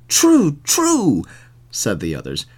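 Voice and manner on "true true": high-pitched double agreement; agreeing townsmen. A narrator's reading. Townsmen agreeing with one of their own, high-pitched. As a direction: lift the voice high for the chorus.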